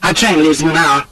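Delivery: high, curt woman's voice; sharp. A narrator's reading. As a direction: announce it sharply, brooking no argument. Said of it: "says Annette sharply".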